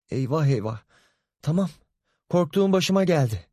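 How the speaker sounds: high, fearful mother's voice; mother fearing the worst; mother hearing her fear come true; alarmed mother. Acted. Mother dreads the news.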